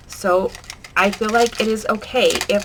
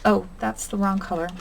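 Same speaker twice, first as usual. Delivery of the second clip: ordinary delivery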